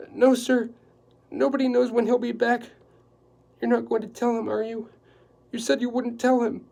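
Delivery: a high, loud, flustered housekeeper; anxious housekeeper. Acted. Housekeeper explaining the dinner to Quillerin, flustered and high. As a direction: rattle it off in a nervous flurry.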